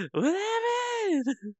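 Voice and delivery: high, drawn-out wail through laughter; helpless laughter. Real, not acted. Streamer reacting to the spin-kicking avatar, helpless laughter. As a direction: laugh so hard the words stretch.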